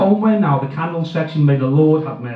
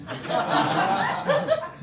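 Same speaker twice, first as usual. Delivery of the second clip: high breathy giggle; 10 dB quieter than usual. Real, not acted. Laughing at wife grenade.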